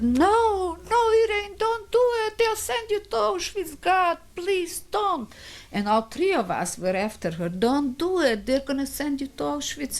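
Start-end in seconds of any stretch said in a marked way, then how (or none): 0.0-5.3 very high, desperate cry of a mother; frantic plea. Retold or imitated. Mother begging Irene not to risk Auschwitz, frantic high plea. 7.5-10.0 high, anxious retold begging; urgent plea. Three women chasing after the niece, urgent high plea.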